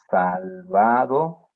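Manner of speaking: Salvado stretched slowly with a rising end. Shown as a demonstration. Teacher contrasting word endings for students, slowly. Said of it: "el ado, ador".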